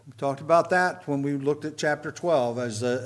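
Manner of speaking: normal talking voice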